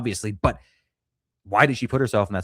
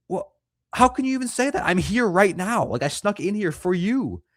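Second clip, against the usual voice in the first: much higher, indignant put-on voice; mock defensive husband; 9 semitones higher than usual. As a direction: whine back like the offended husband. Mocking husband defending himself.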